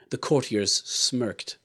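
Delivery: usual speaking voice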